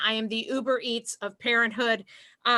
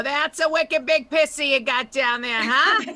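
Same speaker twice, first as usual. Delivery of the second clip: put-on loud, brash Boston accent; 3 semitones higher, 6 dB louder than usual. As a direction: ham up a thick Boston accent. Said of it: "the accent was affecting my sex life".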